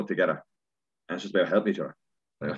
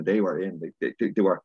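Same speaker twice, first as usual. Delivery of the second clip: ordinary talk, nothing marked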